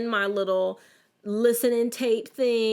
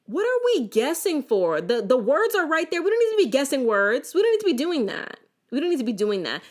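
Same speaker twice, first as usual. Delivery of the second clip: higher and louder, exasperated; worked-up rant; 7 semitones higher, 5 dB louder than usual. Real, not acted. Vlogger to viewers, worked-up exasperated rant. Ranting against guessing words.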